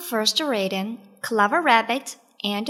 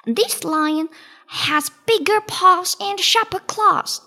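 high, louder rabbit voice; Clever Rabbit; 8 semitones higher, 5 dB louder than usual. A narrator's reading. Rabbit tells the lion of a rival, high voice. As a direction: talk up the threat as a small rabbit. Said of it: "said Clever Rabbit".

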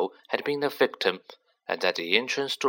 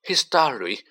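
sobbing; 6 semitones higher, 4 dB louder than usual. Acted. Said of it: "Ginny sobbed".

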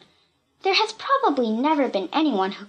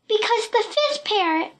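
sobbing; 11 semitones higher than usual. A narrator's reading. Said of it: "sobbed Pee-Pee".

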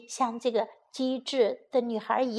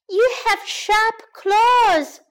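put-on high, slow storybook girl; wary young girl; 9 semitones higher, 5 dB louder, much slower than usual. Girl pointing out the lion's claws, high wary girl voice. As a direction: pitch it up as a worried girl.

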